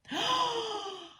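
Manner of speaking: a high, sudden gasp of surprise; sharp gasp. Real, not acted. Reader gasps at the second card, high sharp gasp. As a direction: gasp as if the card just shocked you.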